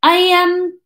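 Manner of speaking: stall, then improvise a cover story; thinking quickly. A narrator's reading. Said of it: "thinking quickly".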